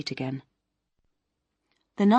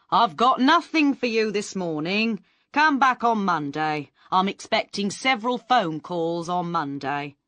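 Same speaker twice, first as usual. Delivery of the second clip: louder, lightly voiced brush-off; breezy agent; 4 semitones higher, 4 dB louder than usual. Mister Bitham dismisses the waiting actors, a lightly louder breezy voice. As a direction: send them away with a smile.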